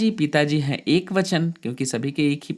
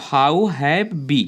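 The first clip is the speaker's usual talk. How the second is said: slow dictation; 3 dB louder, much slower than usual. Shown as a demonstration. Teacher dictating a sentence.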